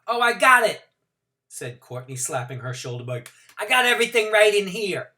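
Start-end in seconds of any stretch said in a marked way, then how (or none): 0.1-0.8 put-on loud, high, breezy young mother; gushing, careless Courtney. Courtney voiced. 3.6-5.1 put-on loud, high, breezy young mother; gushing, careless Courtney. Courtney voiced.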